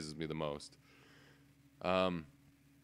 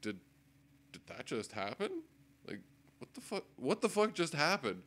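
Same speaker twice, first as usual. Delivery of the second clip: stunned disbelief; 6 semitones higher than usual. Retold or imitated. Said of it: "I was just kinda like".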